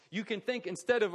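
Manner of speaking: ordinary talk, nothing marked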